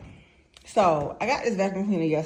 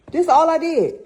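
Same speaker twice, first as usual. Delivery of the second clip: excited; 6 semitones higher, 7 dB louder, faster than usual. Real, not acted. Showing hallway dirt amazed.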